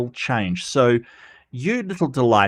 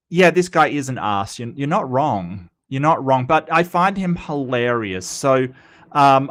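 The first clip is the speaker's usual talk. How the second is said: ordinary delivery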